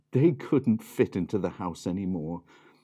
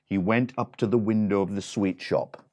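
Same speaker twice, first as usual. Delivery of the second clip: plain reading voice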